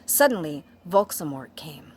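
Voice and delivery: ordinary delivery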